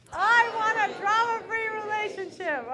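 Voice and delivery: high, loud rallying cry; dramatic declaration. Shown as a demonstration. Speaker urging the room to outdo itself, high and dramatic. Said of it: "you can do better than that".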